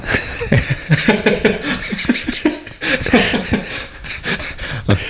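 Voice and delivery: long, high, wheezy laugh; helpless laughter at your own memory; real laughing fit. Real, not acted. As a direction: crack up in a long high giggle.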